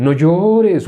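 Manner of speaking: singsong imitation of empty consolation; mock religious consoling. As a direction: parody hollow pious sympathy. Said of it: "Sería como una religión".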